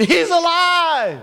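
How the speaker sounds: high, drawn-out exultant cry; mock scream. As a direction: imitate a scream of joy, stretching it out.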